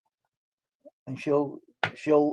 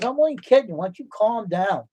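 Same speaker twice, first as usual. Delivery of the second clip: higher-pitched retold protest; imitated protesting husband; 6 semitones higher than usual. Husband telling angry wife it was a joke, imitated protest. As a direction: plead innocence in a raised pitch, as if quoting.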